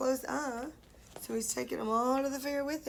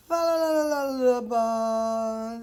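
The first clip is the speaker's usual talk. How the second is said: sheep snore voice; 2 semitones lower, 4 dB louder than usual. Acted. Sheep's snoring noise.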